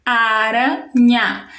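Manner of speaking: ARANHA slow, in separated beats a, ra, nha; split into syllables. Shown as a demonstration. Teacher repeating the word for pupils to count, syllable by syllable. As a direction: say aranha slowly, breaking between syllables. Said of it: "Três sons, três sílabas".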